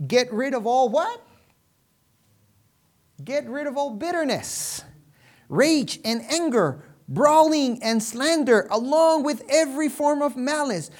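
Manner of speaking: loud, high-pitched proclamation, slowing toward the end; forceful Scripture preaching. Real, not acted. Preacher reading Paul's words to the congregation, loud and emphatic. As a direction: proclaim each phrase to the whole church.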